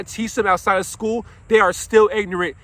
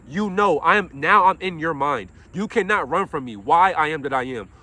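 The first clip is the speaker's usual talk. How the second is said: ordinary talk, nothing marked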